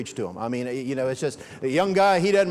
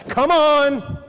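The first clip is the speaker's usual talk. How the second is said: impatient; 5 semitones higher, 5 dB louder than usual. Retold or imitated. Foot tapping, impatient.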